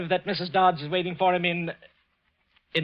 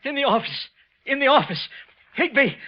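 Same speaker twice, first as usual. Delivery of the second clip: terrified; 10 semitones higher, 3 dB louder than usual. Acted. Wilson panicked after seeing ghost.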